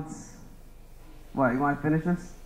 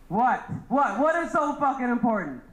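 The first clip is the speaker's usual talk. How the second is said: sharp, higher irritated what-what; annoyed at interruption; 8 semitones higher than usual. Real, not acted. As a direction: snap back at the heckler, higher. Comic snaps at interrupting audience.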